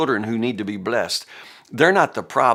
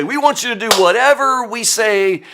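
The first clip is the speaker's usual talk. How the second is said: much higher and louder, a whiny spoiled demand; mocking entitled demand; 11 semitones higher, 7 dB louder than usual. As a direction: mimic the brothers like pushy kids. James and John demanding from Jesus.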